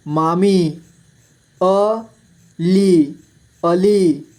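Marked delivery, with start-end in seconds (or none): 1.6-3.1 अली split slowly into अ, ली; syllable by syllable. Shown as a demonstration. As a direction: stretch and separate अ and ली in अली. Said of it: "इस तरह से आपको". (अली को तोड़कर धीरे: अ, ली)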